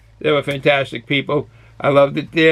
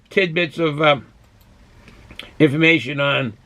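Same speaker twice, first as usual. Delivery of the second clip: ordinary talk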